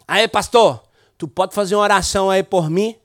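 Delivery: the request spoken in Brazilian Portuguese. Retold or imitated. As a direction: ask for prayer in Portuguese, like the young man did. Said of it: "voy a hablar en portugués".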